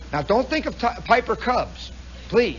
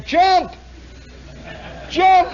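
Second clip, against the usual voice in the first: high, loud crowd shout; hollering; 10 semitones higher, 6 dB louder than usual. Retold or imitated. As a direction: egg someone on from the crowd with a yell. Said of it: "the people hollering".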